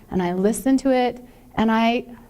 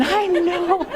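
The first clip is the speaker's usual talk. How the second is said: high, laughing I know; laughing admission; 8 semitones higher than usual. Retold or imitated. To her daughter after the funeral, laughing admission.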